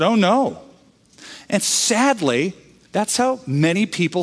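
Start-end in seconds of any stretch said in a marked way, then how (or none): 0.0-0.6 retold high, dismayed "Oh, no"; mock horror at the very idea. Church worker dismayed by old man's question, mock dismay. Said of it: "She said".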